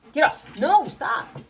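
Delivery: high, loud get off; shooing pets. Real, not acted. Owner fending off climbing pets, high loud. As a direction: shove the pets off.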